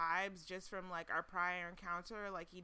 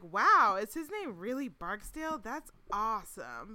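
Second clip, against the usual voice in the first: retold amazement, lifted and emphatic; impressed; 2 semitones higher, 8 dB louder than usual. Host recalling first seeing his name, mock amazed.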